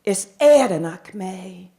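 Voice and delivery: "Is Eranakme" spoken slowly in Irish Gaelic; lilting irish storyteller. Shown as a demonstration. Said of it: "I can speak Irish".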